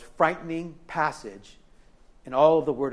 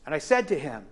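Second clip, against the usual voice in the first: ordinary delivery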